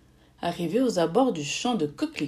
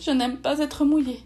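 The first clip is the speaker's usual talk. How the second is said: higher-pitched fretful complaint; dismayed exclamation; 6 semitones higher, 4 dB louder than usual. A narrator's reading. Charlotte caught in the rain, dismayed complaint. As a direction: protest in a high voice. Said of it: "s'exclama Charlotte".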